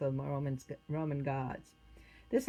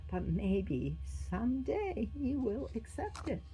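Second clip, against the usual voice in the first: slow, higher, wistful musing; slow and regretful; sad thought; 7 semitones higher, slower than usual. A narrator's reading. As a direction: think it sadly, with faint hope. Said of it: "Valentinus sadly thought".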